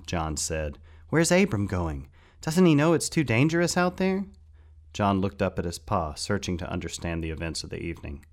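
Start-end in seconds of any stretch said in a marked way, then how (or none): 1.1-4.2 put-on loud, high, worried boy; alarmed protest. A narrator's reading. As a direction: raise the voice in anxious disbelief. Alarmed boy protests Abram leaving.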